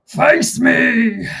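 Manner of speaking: gruff bugbear challenge. Acted. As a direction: bark it like a bugbear. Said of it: "He says".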